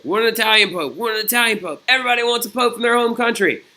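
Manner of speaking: high, insistent crowd chant; demanding crowd. Acted. Italian people demanding their own pope, high insistent chanting. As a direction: chant it like a clamoring mob, pitch held high. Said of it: "begin to demand that they want".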